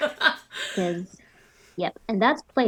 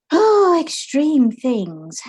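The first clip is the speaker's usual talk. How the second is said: slow, louder and higher, stretching each word; drawn-out emphatic exclaim; 8 semitones higher, 8 dB louder, much slower than usual. Acted. Character urging patience stretches out the warning, emphatic. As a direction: lean hard into the last words. Character stresses extreme measures.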